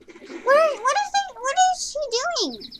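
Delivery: squeaky high puzzled question; baffled, pitch shooting up; high, startled what-is-she. Real, not acted. Puzzled at puppy in game.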